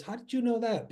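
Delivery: put-on a startled old neighbor's gasp of a question; astonished. Retold woman stunned at phone.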